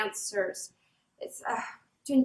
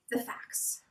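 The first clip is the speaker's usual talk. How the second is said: hushed whispered aside; whispered lead-in; 12 dB quieter than usual. Acted. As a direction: drop to a secret whisper.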